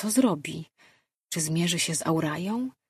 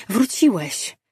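higher, louder exclamation; exclaimed; 4 semitones higher, 7 dB louder, slower than usual. A narrator's reading. Arley sees Lejard is himself again, exclaiming. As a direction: cry out at a sudden return. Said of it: "wykrzyknęła Arley".

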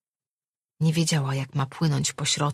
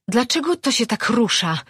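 panting; 8 semitones higher, 4 dB louder than usual. A narrator's reading. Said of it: "wysapała".

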